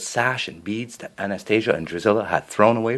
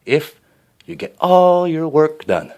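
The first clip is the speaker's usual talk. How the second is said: sly condition; 8 semitones higher, 7 dB louder, slower than usual. A narrator's reading. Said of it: "she said slyly".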